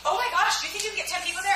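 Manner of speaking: high, excited gushing; acted-out giddy disbelief; thrilled friend imitation. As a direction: squeal it like an overjoyed friend. Retells friend's thrilled reaction.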